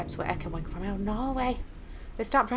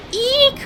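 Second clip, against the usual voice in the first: high, loud squeal of fright; startled squeal; 13 dB louder than usual. Acted. Reader voicing a frightened game character, startled squeal. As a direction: squeal sharply in sudden alarm.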